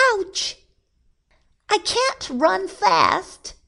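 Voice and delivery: very high, slow whining rabbit voice; distressed rabbit. A narrator's reading. Rabbit fleeing down the road, high distressed voice. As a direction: squeal it in mild distress.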